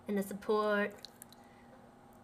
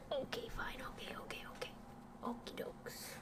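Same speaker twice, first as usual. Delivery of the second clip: soft ASMR whisper; hushed ASMR murmur; 13 dB quieter than usual. Real, not acted. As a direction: whisper it close to the mic.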